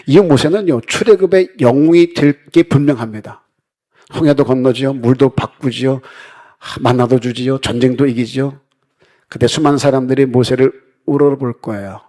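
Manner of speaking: loud, rising rhetorical questions from the pulpit; forceful preaching. Acted. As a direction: preach it out to the congregation. Pastor building up Moses' glory. (힘 있게 설교하듯)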